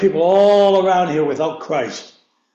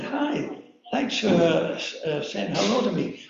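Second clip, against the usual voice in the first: much softer, hesitant stranger's thanks; soft reply; 9 dB quieter than usual. Retold or imitated. Late-night stranger thanking the preacher, soft and hesitant. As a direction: answer quietly, a little unsure.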